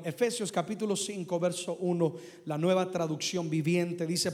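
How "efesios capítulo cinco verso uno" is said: usual speaking voice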